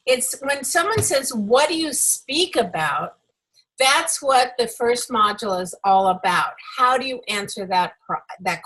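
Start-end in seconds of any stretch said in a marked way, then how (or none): none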